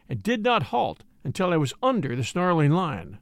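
ordinary delivery, nothing marked

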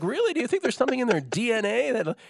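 teasing disbelief at dad's claim; incredulous really. Retold or imitated. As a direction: voice shoots up high in disbelief. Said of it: "And I'd be like".

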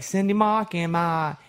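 imitated Australian accent, r's dropped; Nikita's Australian accent. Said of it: "she couldn't say Cindy, Mark, and Mike".

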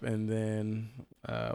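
ordinary delivery

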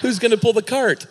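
high laughing parent voice; mock farmer parent. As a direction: play the worried farmer, laughing. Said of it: "voicing the parents".

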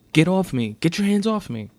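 high, loud panicked protest; yelling. A narrator's reading. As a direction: yell at him to let go. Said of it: "she yells".

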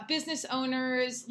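ordinary talk, nothing marked

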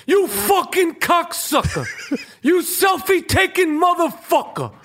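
high, slow furious tough-guy insult; Danny Aiello impression. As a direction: spit it out like Sal from the pizzeria. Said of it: "That's my Danny Aiello from Do the Right Thing".

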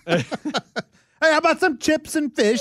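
laughing through a high fake English accent; mock English accent. Golfer ordering food in a fake English accent. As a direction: put on a silly English accent while laughing. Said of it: "enough of the English accent".

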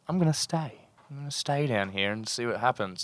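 hushed self-talk, held breath; quiet inner voice. Retold or imitated. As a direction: murmur it to yourself underwater. Inner voice deep underwater.

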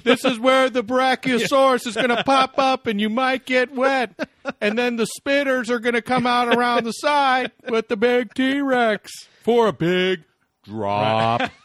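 high-pitched full-volume ride spiel; yelling. Acted. Host hypes dinosaur ride to waiting line, yelling. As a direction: yell it over the crowd, stretching the last words. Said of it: "why are you yelling so loud".

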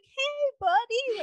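a big-smile hey buddy, pitched very high; John Elway greeting. Retold or imitated. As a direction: pitch it way up like a grinning celebrity saying hi. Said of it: "John Elway's looking at him like".

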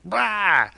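sudden high "rah" of impact; imitated crash noise. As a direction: voice a mock crash explosion.

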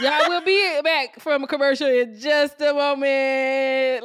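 loud, drawn-out announcement through laughter; jokey break announcement. Real, not acted. Co-host escaping to a commercial, loud laughing announcement. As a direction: call it out loud, stretching the last word.

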